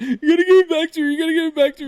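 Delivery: very high, loud preteen insisting; shrill twelve-year-old pleading; hammed-up high boy demand. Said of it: "just our voices then".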